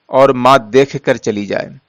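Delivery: usual speaking voice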